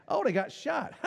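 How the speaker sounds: a mermaid chuckling at a TV death, high and amused; laughing mermaid. Retold or imitated. Mermaid laughing at the TV show, amused. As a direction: laugh through the words. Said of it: "Then she's laughing at it".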